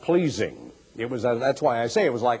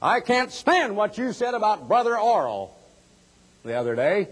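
mock offended listener; 7 semitones higher than usual. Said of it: "They say".

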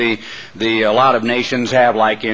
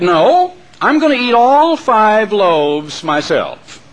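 defiant hen; 7 semitones higher, slower than usual. A narrator's reading. The hen refusing the mob, high and slow. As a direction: dig in and refuse, slowly. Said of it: "But the little red hen said".

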